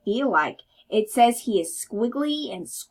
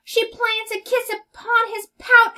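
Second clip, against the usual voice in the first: high, loud surprised telling; delighted narration; 11 semitones higher, 5 dB louder than usual. A narrator's reading. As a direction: gasp it out with delight. Surprise kiss in story.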